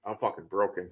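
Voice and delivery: usual speaking voice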